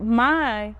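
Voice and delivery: ordinary talk, nothing marked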